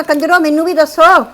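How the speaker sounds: normal talking voice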